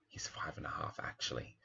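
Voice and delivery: put-on soft breathy whisper, a kid letting the truth slip; whispered correction. A narrator's reading. Child at the booth whispering the real age to Dad. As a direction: whisper it like an embarrassing aside.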